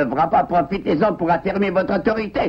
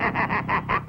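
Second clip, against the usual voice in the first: menacing chuckle; 6 dB quieter than usual. Acted. Wolf laughs after his threat.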